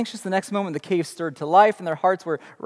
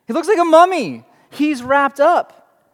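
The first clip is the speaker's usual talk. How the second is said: excited; 8 semitones higher, 5 dB louder than usual. Real, not acted. Excited storytelling aside.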